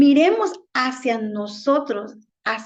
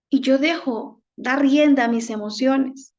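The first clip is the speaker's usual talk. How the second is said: ordinary talk, nothing marked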